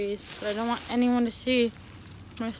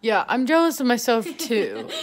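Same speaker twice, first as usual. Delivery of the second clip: louder, laughing through it; 6 dB louder than usual. Real, not acted. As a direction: laugh while saying it. Laughing at her own joke.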